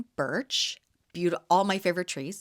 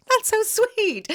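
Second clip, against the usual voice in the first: high, louder, slow gushing; delighted; 5 dB louder, slower than usual. Retold or imitated. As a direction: gush over a sweet gift. Touched by a gift.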